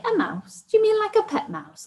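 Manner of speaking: high-pitched, flippant mock disbelief. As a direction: query it flippantly, pitch leaping well up. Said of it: "flippantly say".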